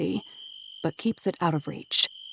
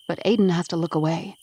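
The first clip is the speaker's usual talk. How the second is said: usual speaking voice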